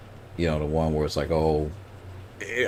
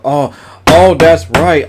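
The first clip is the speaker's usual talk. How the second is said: sudden realization; 7 semitones higher, 6 dB louder, much slower than usual. Real, not acted. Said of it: "I forgot".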